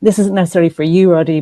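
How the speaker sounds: ordinary delivery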